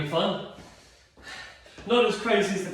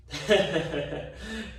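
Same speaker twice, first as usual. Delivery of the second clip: tired chuckle. Real, not acted. Laughs at own tiredness.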